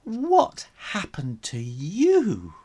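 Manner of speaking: high, slow, sympathetic aunt's cry; high, slow fussing concern. Acted. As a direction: coo over him with high pity. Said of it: "cried Aunt Loopy".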